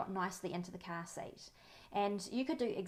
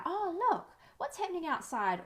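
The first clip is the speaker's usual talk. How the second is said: put-on much higher and louder, excited to catch a toddler's eye; bright distraction; 10 semitones higher, 5 dB louder than usual. Shown as a demonstration. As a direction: sound thrilled about something out the window.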